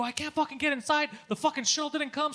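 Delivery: high-pitched frustrated complaint; worked-up, exasperated retelling; retold yelling. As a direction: vent helplessly about being locked out. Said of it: "he's just yelling all the time".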